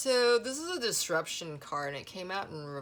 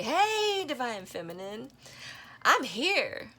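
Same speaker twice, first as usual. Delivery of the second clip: mock cheerful greeting; 7 semitones higher, 5 dB louder than usual. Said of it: "very well pick up and just say".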